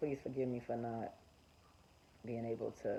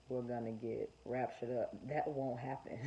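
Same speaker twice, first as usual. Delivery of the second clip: ordinary talk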